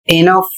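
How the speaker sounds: इनफ said as i-nuff with the stress on नफ; demo pronunciation. Shown as a demonstration. Teacher modelling the word इनफ for students. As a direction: land इनफ on a firm final f. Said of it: "इसका अर्थ होता है पर्याप्त".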